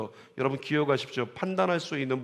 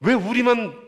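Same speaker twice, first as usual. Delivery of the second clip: complaining members; 7 semitones higher, 7 dB louder than usual. Retold or imitated. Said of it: "이렇게 얘기하는 사람들이".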